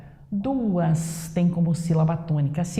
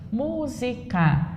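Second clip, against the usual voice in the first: Música in slow, separate syllables, higher start; split into syllables; 4 semitones higher, much slower than usual. Shown as a demonstration. Teacher shows students the division, syllable by syllable. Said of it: "Eu dividi assim".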